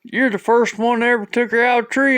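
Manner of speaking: high, loud punchline; hammed-up outburst, fast and high; mock overjoyed gratitude. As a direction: act out a grateful dad crying it out. Grateful dad delivers the punchline.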